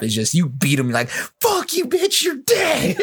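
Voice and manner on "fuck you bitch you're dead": high-pitched acted victory yell; gloating triumph. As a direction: crow it over a beaten boss, drawing out the end. Acting out triumph over beaten boss.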